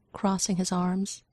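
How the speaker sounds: usual speaking voice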